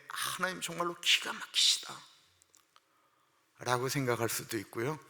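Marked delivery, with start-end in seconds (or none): 0.1-3.9 slow, awed praise; amazed admiration. Retold or imitated. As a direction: savor each word in wonder. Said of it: "너무나도 적절한 말씀이기 때문에". (감탄하며 천천히 음미하듯)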